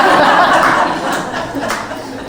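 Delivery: breathy high laugh bursting out; high burst of laughter. Real, not acted. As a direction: laugh at the punchline. Laughing at his own story.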